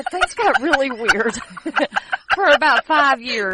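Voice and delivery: laughing. Real, not acted. Laughing about weird times.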